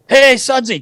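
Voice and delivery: high, loud mock call across the room. Trainer calling the young equipment kid over, high and loud. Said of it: "and he goes".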